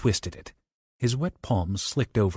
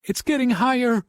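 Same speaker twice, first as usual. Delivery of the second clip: much higher, louder terrified wail; 4 dB louder than usual. Acted. Bobby wails to Johnny as water rises, high terrified. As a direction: wail it in fear. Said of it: "Bobby wailed".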